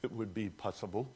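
a soft, composed, measured prayer; the prayer he did not say, lightly voiced; mild calm request. Preacher voicing the calm prayer Jesus didn't pray, softly. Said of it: "He's not saying".

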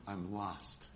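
soft, low admission of defeat; hushed, sunken confession; quiet confession. Retold or imitated. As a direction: drop low and soft, as if owning up. Peter at bottom, confessing need.

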